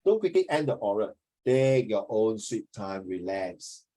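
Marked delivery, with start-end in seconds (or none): 1.5-3.8 calm, unhurried. Shown as a demonstration. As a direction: ease them into relaxing. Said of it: "coaching calm".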